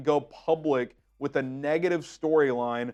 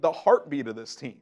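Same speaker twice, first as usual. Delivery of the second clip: usual speaking voice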